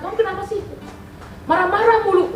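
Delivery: exasperated confronting. Retold or imitated. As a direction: replay an irritated challenge to a friend. Retold confronting a grumpy friend.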